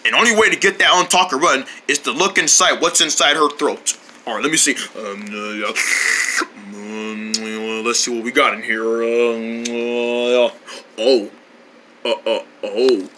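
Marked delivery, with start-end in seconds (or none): 4.3-13.1 low, quiet doctor voice. Acted. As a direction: murmur like a doctor checking a throat. Doctor examines throat.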